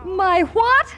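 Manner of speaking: sudden high, loud "what?"; jolted by shocking news; a sharp, surprised outburst; startled disbelief. Acted. Shocked at the baby gift.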